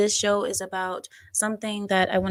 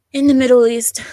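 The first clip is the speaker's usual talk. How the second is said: grand storyteller opening; 4 semitones higher, 9 dB louder than usual. Pretend.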